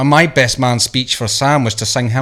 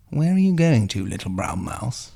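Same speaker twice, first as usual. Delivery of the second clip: snooty fox; 8 dB quieter than usual. Acted. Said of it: "I always went with a bit of a snooty bastard".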